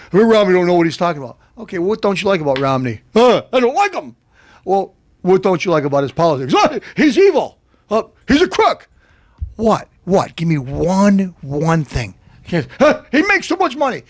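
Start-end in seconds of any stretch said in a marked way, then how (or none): 3.1-4.1 high-pitched mock dimwit; whiny cartoon complainer; dim barbershop guy. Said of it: "when I talk to some guy". 6.5-8.9 very high mock dimwit voice; mocking imitation of barbershop talker; dim barbershop guy. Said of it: "when I talk to some guy". 12.8-14.0 high mock complainer voice; hammed-up dimwit whine; dim barbershop guy. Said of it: "when I talk to some guy".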